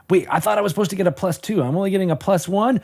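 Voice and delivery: high, whiny-outraged protest at a rule; indignant protest. Acted. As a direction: cry foul like an aggrieved young player. Acting out aggrieved young player's protest.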